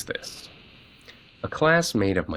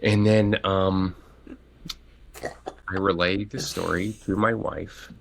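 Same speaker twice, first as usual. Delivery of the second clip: usual speaking voice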